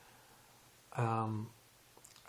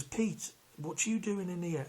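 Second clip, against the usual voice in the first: imitating a friend's surprise, much higher; mock astonished hello; surprised greeting; 7 semitones higher, slower than usual. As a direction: retell his startled hello, pitched way up. Old acquaintance spots him in custody.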